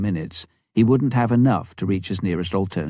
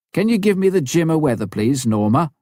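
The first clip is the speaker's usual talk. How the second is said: higher, louder, quicker radio call; a pilot calling base over the radio, quick and raised; 8 semitones higher, 4 dB louder than usual. A narrator's reading. Tom on the radio.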